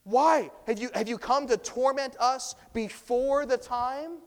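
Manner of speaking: a preacher voicing a panicked demon, loud; loud demon cry. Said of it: "they also say".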